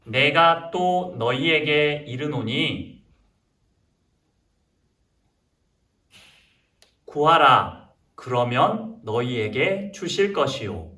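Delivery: slow, measured Bible recitation; scripture reading. Acted. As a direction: read it out deliberately for children to repeat. Leads children's scripture reading.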